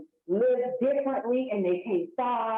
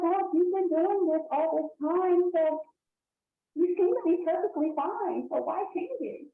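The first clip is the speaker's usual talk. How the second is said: higher, lightly voiced coaxing receptionist; higher secretary voice; 8 semitones higher than usual. Secretary coaxing the dieter to stay the same, higher. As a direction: talk them out of changing, pitched up. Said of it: "the secretary is like".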